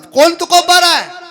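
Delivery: high, loud, slow proclamation; stretched declamatory cry; ringing rhetorical question. Real, not acted. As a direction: throw the question out to the whole hall. Rhetorical refrain to congregation.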